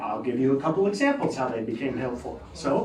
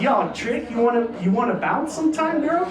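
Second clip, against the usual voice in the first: mock thug slang; 9 semitones higher than usual. A kid hitting on a girl in fake street talk. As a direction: play the wannabe gangster lightly. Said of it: "Instead of".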